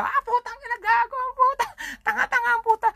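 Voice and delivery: very high, squeaky cartoon voice spitting curses; mock the fallen kid in a tiny shrill-pitched voice; squeaky mocking dog. Dog laughing at the fallen biker, very high mocking squeak.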